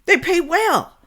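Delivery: high, emphatic objection; riled-up protest; indignant. Real, not acted. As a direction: push back hard, voice up. Indignant pushback at guest.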